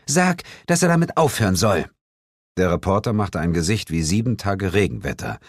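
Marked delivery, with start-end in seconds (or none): 0.1-1.9 high, whiny complaint; a wife sulkily protesting; whining. A narrator's reading. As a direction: pout and whine at Bill. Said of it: "maulte Sheila".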